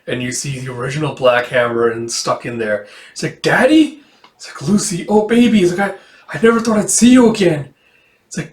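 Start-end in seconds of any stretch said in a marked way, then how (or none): 3.4-4.0 much higher, louder startled cry; a sudden stunned call to him; shocked cry. Acted. Lucy seeing her father caged, shocked. 4.6-7.7 higher, louder emotional reunion; tearful father. Acted. Father greeting his daughter in hell, overcome.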